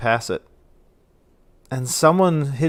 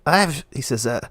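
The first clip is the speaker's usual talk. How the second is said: ordinary talk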